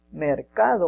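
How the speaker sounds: mercado with the middle syllable CA stressed. Shown as a demonstration. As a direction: lean on the CA in mercado. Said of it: "un poco más de intensidad".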